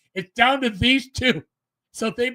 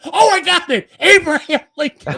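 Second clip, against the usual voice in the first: higher and louder, sure she knows; excited eureka; 7 semitones higher, 6 dB louder than usual. Retold or imitated. Contestant girl proudly blurting the wrong answer, excited and loud. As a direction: blurt it out as if you just remembered.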